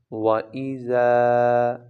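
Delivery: زا held for two counts, slowly; slow drawn-out زا; long madd. Shown as a demonstration. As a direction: stretch the alif madd in زا. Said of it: "دو second تک لمبا کر کے پڑھنا ہے".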